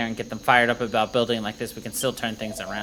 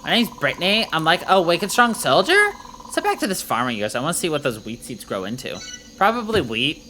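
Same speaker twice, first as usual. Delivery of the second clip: lightly voiced bubbly valley girl; a hint of a chirpy valley girl; 3 semitones higher, 3 dB louder than usual. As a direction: play the hyped valley girl soldier, like, totally. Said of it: "uh, valet voice".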